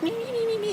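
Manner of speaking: high squeaky ferret noises; imitating ferrets scurrying out; ferret squeaks. Imitating ferrets coming back.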